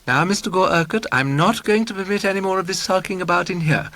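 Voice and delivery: high-pitched, sprightly scolding; vivacious. A narrator's reading. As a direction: tease him with bright, social liveliness. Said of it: "said vivaciously".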